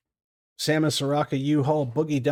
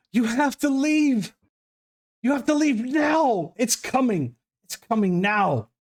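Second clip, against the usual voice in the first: high, urgent pleading warning; 10 semitones higher than usual. Acted. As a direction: beg them to leave, voice high and desperate. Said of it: "there's a pleading voice".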